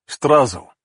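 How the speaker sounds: put-on "Strazo" in a Russian accent, rolled R. Russian interpreter repeating the word, Russian accent. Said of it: "but with a Russian accent".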